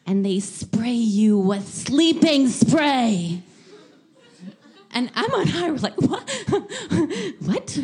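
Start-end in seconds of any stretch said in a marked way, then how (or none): 0.1-3.4 slow, louder, deadly serious warning; grave warning. Retold or imitated. Czech woman warns about gangs. 6.0-7.8 shocked what. Retold or imitated. Shocked reaction to gang story.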